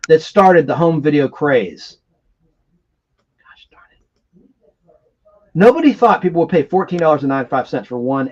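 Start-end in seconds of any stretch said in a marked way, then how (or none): 3.4-4.6 whispered. Real, not acted. Muttered fumbling with tape.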